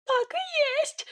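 very high, drawn-out piping voice; shrieky little-man affirmation; squeaky Sidor confirming. Acted. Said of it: "подтвердил Сидор".

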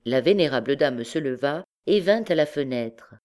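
ordinary delivery

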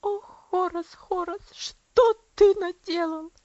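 loud, slow, tearful lament; grieving aunt wringing her hands and crying; anguished crying. Acted. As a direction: sob out the reproach. Aunt breaks down in grief.

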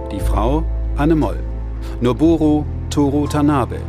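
ordinary talk

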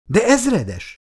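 high-pitched cry of objection; shocked protest. A narrator's reading. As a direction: object in alarm to a superior. Major protests the colonel's order.

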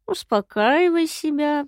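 put-on high, slow, creaky old voice; creaky drawl. A narrator's reading. Stranger mocking the imp's doubt, creaky drawl. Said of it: "протянул мужчина скрипучим голосом".

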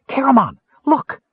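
urgent jab of excitement; excited kender. Acted. Tasselhoff nudging Caramon to look, excited. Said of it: "the Kender said in excitement".

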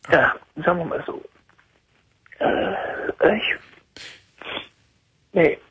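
tearful, broken voice between sobs; crying. Real, not acted. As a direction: speak through real tears, voice cracking. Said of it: "Weinst du?".

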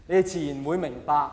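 usual speaking voice

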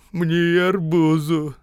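high, very slow begging whine; beggar woman's voice. A narrator's reading. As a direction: plead like a beggar, dragging the words. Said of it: "сказала голосом нищей".

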